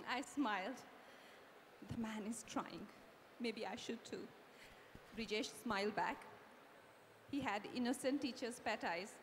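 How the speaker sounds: soft, low first-person narration; story narration. A narrator's reading. Radhika's private thoughts on the man, soft low narration. Reads book narration aloud.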